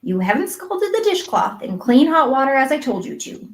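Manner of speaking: unyielding reproof, understated; lightly voiced firm scolding; immovable. A narrator's reading. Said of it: "said Marilla immovably".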